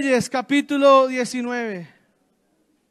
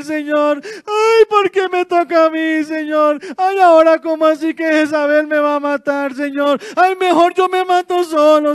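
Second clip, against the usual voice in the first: whiny crybaby; 6 dB louder than usual. Pretend. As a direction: wail like a spoiled child complaining to God. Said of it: "llorando como un niño". (queja llorona de niño, aguda y fuerte)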